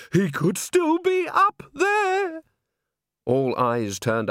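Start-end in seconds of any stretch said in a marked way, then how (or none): 0.1-2.4 high, slow, eerie warning; spooked bystander. Acted. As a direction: drag it out, haunted and high.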